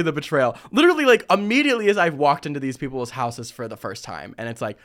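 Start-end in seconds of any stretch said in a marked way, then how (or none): none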